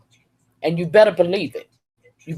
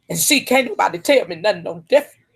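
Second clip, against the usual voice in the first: normal talking voice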